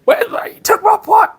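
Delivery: put-on high, squeaky old-lady yell; mock yelling old lady. Old neighbour lady yelling at father over pipe, high mock shriek. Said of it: "she'd yell at him".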